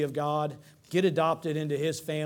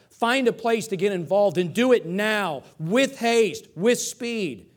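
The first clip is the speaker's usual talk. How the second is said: loud, raised-pitch preacher's urging; urgent exhortation; 5 semitones higher, 7 dB louder, slower than usual. Acted. Preacher voicing Paul's charge to the church, urgent and loud. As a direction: press the command home, loud and high.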